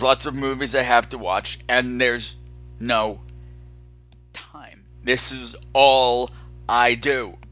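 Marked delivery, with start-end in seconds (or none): none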